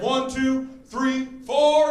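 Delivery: shouted marching count; cadence call. Retold or imitated. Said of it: "and we'd all yell".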